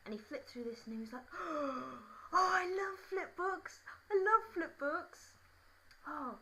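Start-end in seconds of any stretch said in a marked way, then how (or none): 1.3-6.4 high, loud, drawn-out mock gushing; slow, squealing put-on delight; overexcited schoolboy. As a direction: gush like a thrilled boy, hammed-up. Acts boy thrilled by flip book.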